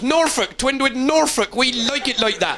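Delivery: high, proud Norfolk yokel voice; mock Norfolk yokel. Norfolk sign greeting drivers, in a mock Norfolk yokel voice. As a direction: read the road sign as a smug Norfolk local. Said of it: "you drive up to Norfolk, big signs".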